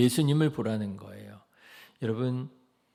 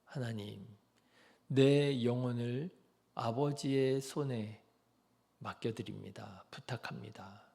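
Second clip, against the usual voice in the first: Jesus's last quiet words to the Father; surrendering prayer; 2 semitones lower, 5 dB quieter than usual. Pretend. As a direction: give yourself over, barely audible.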